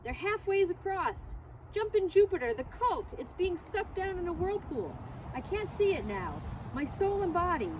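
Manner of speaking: a fisherman calls out a dangerous crossing, nervous and high; nervous report. A narrator's reading. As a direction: report it in short nervous breaths. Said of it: "reporting in short, nervous sentences".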